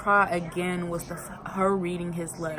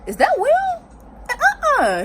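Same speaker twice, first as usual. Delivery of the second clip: high, loud shriek of disbelief; stunned fan shrieking at the screen; shocked disbelief; 6 dB louder than usual. Retold or imitated. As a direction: squeal like you can't believe your eyes. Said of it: "I'm like".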